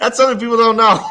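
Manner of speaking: blurted through a loud laugh; cracking up while telling it. Real, not acted. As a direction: laugh it out, amazed. Laughing about Burke shakedown.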